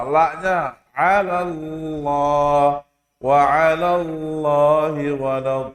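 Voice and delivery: 'ala Allah drawn out and sung slowly with no added w; slow recitation. Shown as a demonstration. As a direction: chant 'ala Allahi walad slowly and cleanly. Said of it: "Nggak boleh ditambahin W".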